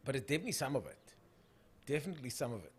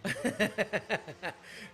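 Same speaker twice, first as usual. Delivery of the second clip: real burst of laughter; sustained amused laugh; 5 semitones higher, 4 dB louder than usual. Real, not acted. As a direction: crack up laughing. Laughs at a viewer comment.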